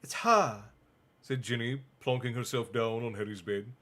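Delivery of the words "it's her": lightly voiced annoyed girl; a hint of a sulky sister. Ginny irritated.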